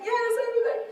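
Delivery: high-pitched, mock tearful assurance; imitating herself crying and agreeing; retold tearful yes. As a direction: squeak out an over-eager yes. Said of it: "And I'm crying".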